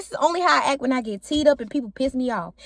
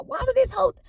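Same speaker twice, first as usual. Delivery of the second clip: retold scream; 10 semitones higher than usual. Screaming about her rival in the retold argument, a high mock scream. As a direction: imitate your own screaming, pitch jumping up. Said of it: "I'm screaming".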